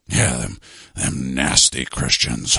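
deep, slow, sinister villain; deep mock villain sneering at his enemies. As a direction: growl it low like a cartoon villain.

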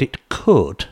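"could" set apart and stressed, pause after. Real, not acted. Sceptical host qualifies a jab claim, stress on "could". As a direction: lean on the word "could" to show doubt. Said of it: "I stress the word".

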